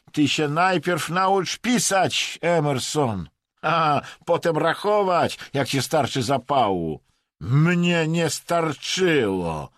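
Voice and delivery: loud, high, drawn-out taunting; mocking lecture. A narrator's reading. Vadim tells Emerson to learn writing, tauntingly.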